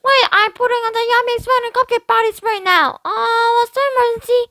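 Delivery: little sister chattering from the bathroom; little girl voice. Acted. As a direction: play a carefree little girl. Brianna voiced.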